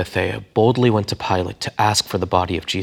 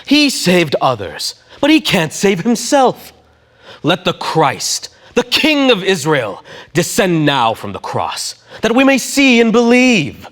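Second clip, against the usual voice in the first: slow, loud, high scornful taunt; mocking; 11 semitones higher, 6 dB louder, slower than usual. A narrator's reading. Chief priests mocking Jesus together, slow and loud. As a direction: drag out the jeer, high and loud. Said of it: "mocking among themselves".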